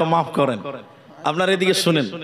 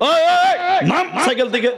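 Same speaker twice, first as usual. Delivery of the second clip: mock gang yell; 7 semitones higher than usual. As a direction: bark at the passerby. Gang yells at passerby.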